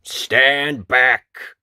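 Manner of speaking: loud command at his own pitch; woodcutter's cry. A narrator's reading. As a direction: call out a firm warning. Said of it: "cried the woodcutter".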